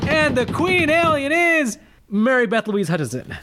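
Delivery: drum-roll reveal with a stretched From Alabama; grand announcement. Acted. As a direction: boom it out like crowning a pageant queen. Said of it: "give me another drum roll".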